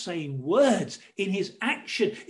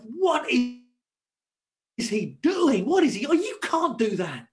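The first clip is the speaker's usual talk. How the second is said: slow, drawn-out scandalised "what is he doing", higher; mock outrage; 6 semitones higher, 6 dB louder, slower than usual. Pharisees appalled at Jesus letting children shout, mock outrage. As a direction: play the shocked religious leaders.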